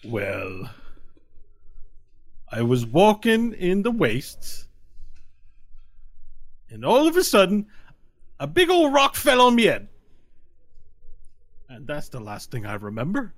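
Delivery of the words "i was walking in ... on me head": rising, louder Irish brogue; Irish leprechaun. Acted. Leprechaun explaining to the party in an Irish brogue. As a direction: tell the tale in a thick brogue. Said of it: "Oh, he's Irish, all right".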